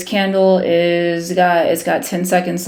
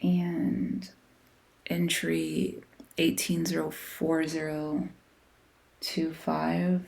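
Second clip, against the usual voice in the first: whispering to himself; 12 dB quieter than usual. Real, not acted. Checking numbers to himself.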